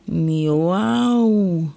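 a milder, shorter meow. A narrator's reading. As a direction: meow calmly, the cat now friendly.

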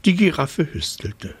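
plain reading voice

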